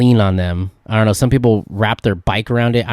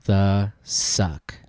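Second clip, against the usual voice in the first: stunned drawl; 2 semitones lower, 6 dB quieter, much slower than usual. Retold or imitated. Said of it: "And I'm like".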